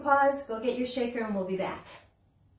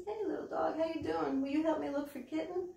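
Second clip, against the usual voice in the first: higher, softer sing-song greeting to a toy dog; sweet puppet greeting; 5 semitones higher, 5 dB quieter than usual. Acted. Librarian coos to the toy dog for the kids. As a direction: coo at the little dog.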